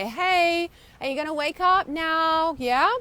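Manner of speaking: the body nudging its owner awake. Retold or imitated. Body voiced calling to you.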